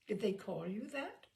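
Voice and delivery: ordinary delivery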